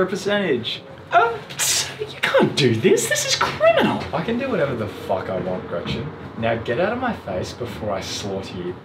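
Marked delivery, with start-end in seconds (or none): 1.1-4.0 very high, indignant cry; shrill outraged protest. Acted. 4.1-8.8 low menacing threat. Acted. Principal threatens teacher quietly.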